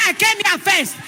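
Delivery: high shout of came. Retold or imitated. Woman insisting to the drivers she came first, shouting. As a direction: plant your claim, shouting.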